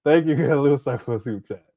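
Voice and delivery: thanks broken by a laugh. Real, not acted. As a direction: thank him while cracking up. Thanking viewer while laughing.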